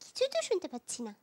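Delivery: low, hushed mutter to oneself; secretive low murmur in a character voice; whispered grumble. Acted. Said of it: "ফিসফিস করে কি যেন বলছো".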